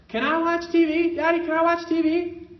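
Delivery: put-on high, loud whiny child asking; begging toddler voice. Toddler pleading with daddy for TV, high and loud. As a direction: beg like a small child for TV.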